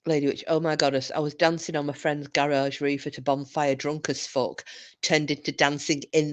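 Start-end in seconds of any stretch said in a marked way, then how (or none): none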